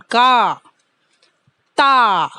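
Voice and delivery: का and ता with the aa held long and loud; long vowel. Shown as a demonstration. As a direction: stretch the aa for kids. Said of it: "long sound".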